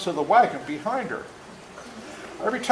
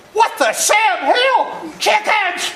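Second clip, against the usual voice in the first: high, loud, slow shout of disbelief; Daddy's shocked outburst; 7 dB louder, much slower than usual. Retold or imitated. Father stepping onto the porch at the chaos, shouting in disbelief. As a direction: bellow it like a father just woken from his nap.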